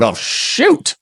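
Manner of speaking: high, startled mock gasp of alarm; a diplomat catching the news, caught off guard; high startled oh shoot. Diplomats watching breaking news.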